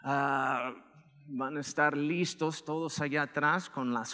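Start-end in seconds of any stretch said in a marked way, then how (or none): none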